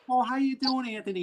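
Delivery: high, sugary in-law welcome, lightly voiced; mock sweet greeting. In-laws greeting him at the door, lightly mimicked sweet voice. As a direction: gush a sweet hello. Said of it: "kiss on the both sides".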